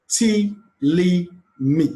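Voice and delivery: each syllable held out separately. Shown as a demonstration. Teacher counting syllables back from the word's end, syllable by syllable. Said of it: "count the third syllable from the end".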